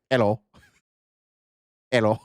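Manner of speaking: mock Mexican accent. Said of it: "That was her Mexican accent".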